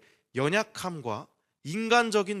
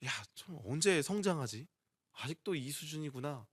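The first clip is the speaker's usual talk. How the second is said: low, soft sigh of self-reproach; understated disappointment, dropping quieter; disappointed self-talk; 5 semitones lower, 5 dB quieter than usual. Acted. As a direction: mildly let down, talking to yourself. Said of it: "실망이 되고 힘들어지는 저를 보면서".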